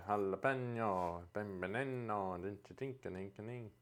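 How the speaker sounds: put-on goofy made-up Italian-or-Swedish accent; vague foreign accent. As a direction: put on an unplaceable foreign accent and drift into nonsense. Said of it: "I don't know what that accent".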